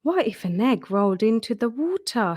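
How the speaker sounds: ordinary delivery, nothing marked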